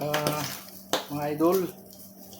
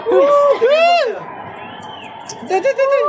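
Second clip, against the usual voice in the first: high shrieking woo; cheering; 12 semitones higher, faster than usual. Real, not acted. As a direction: whoop at the fireworks. Cheering at fireworks.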